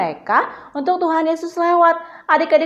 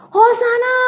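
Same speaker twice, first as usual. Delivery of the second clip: high, loud cheer; demonstrated shout; 10 semitones higher, 5 dB louder than usual. Shown as a demonstration. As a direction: lead children in shouting praise. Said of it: "Coba adik-adik teriak lagi".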